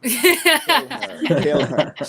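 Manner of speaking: put-on sinister butler prompt; mock murderous urging from a servant. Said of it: "my butler would be like".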